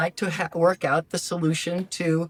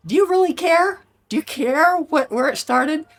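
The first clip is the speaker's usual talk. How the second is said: high, loud, incredulous do you really care; exasperated disbelief; 10 semitones higher, 5 dB louder than usual. Retold or imitated. Wife scoffing at husband's childhood excuse, loud and incredulous. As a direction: scoff at the husband's excuse, much higher and louder.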